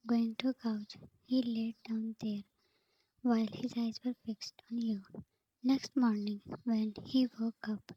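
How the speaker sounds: usual speaking voice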